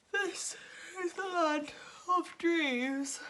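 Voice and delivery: slow drowsy drawl, high start; fading into sleep; sleepy. A narrator's reading. Moonface drowsy after the Sandman's sand, sleepy. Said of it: "said Moonface sleepily".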